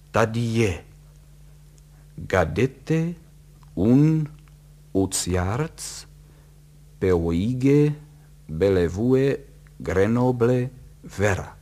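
loud, halting foreign-word readout; loud syllable-by-syllable reading with pauses; deciphering word by word. A narrator's reading. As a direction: sound out each strange word separately, puzzled. Puzzling out a garbled telegram.

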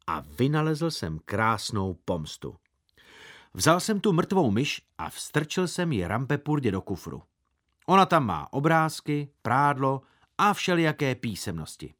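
ordinary delivery

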